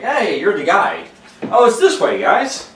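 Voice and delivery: high, loud cheery guide voice; eager tour guide. Retold or imitated. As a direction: call the group along brightly. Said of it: "You kinda like".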